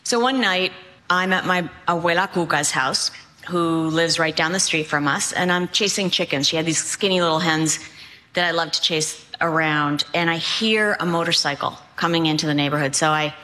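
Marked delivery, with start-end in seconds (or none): none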